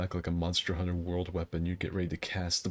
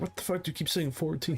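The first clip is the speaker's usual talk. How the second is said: high baffled bully voice; 9 semitones higher than usual. Retold or imitated. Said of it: "the guy that was below was like".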